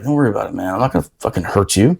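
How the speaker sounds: low, easy reassuring voice; retold soothing aside, pitched low; calm reassurance. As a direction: talk a scared partner down. Reassuring partner scared to death.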